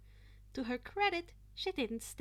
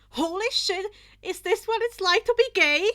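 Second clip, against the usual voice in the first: loud, high, stunned exclamation in another voice; flustered yell; 9 semitones higher, 16 dB louder than usual. Acted. Villain flustered by Izuku's dance, yelling loud and high. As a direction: blurt it out, completely thrown.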